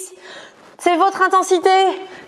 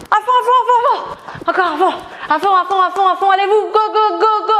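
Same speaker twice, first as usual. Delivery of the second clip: gym bro; 5 semitones higher than usual. Acted. Coach cheering on her class.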